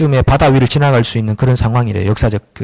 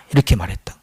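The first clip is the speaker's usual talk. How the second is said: whispered; 8 semitones lower, 5 dB quieter than usual. Acted. Preacher voicing God, whispering the close. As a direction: drop to a whisper on 이렇게 말했다. End of God's speech.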